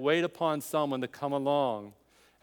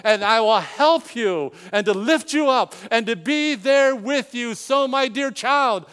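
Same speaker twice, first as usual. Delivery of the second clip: fervent sermon delivery of God's promise; preaching at full force; 7 semitones higher, 9 dB louder than usual. Acted. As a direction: proclaim it like the prophet, swelling to the climax. Preaching Isaiah's words with rising force.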